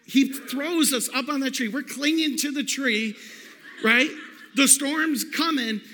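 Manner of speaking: normal talking voice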